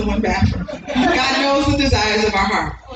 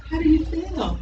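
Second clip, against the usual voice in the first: put-on soft check-in with a child; lightly voiced gentle counselor asking; social worker's question; 3 semitones higher, 6 dB quieter than usual.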